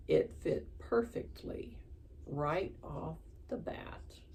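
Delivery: usual speaking voice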